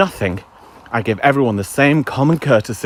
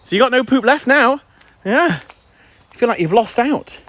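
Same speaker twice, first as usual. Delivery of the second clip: cooing at dog; 10 semitones higher than usual. Real, not acted. Talking to his dog.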